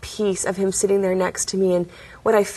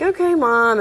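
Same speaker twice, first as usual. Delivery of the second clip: mock kid going along, higher; humouring mom; 5 semitones higher than usual. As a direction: lightly sing out a humouring reply. Kids humouring mother in story.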